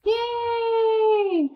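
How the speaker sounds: very high happy yell; excited cheer. Real, not acted. Gamer cheering as the rescue beacon lifts off, a high excited yell. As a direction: cheer like you just won.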